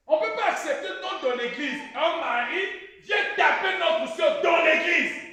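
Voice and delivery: loud preacher cry; fired-up preaching. Acted. Preacher telling the church about an attacker, loud preaching. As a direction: preach it out hard to the hall. Preacher shouts against wife-beating.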